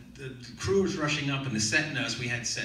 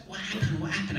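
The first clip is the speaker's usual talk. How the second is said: lightly voiced, higher-pitched nurse; set nurse; 8 semitones higher than usual. Nurse arriving at the fallen actor, lightly voiced and high. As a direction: raise the pitch for a nurse's quick questions. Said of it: "set nurse comes up and goes".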